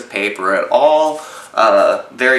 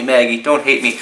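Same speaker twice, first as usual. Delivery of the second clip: ordinary talk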